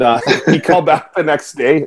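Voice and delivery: ordinary talk, nothing marked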